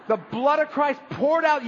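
high, drawn-out proclamation; exuberant proclamation. Acted. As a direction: proclaim it lavishly, stretching the words. Acts out lavish fire-hose communion.

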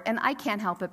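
usual speaking voice

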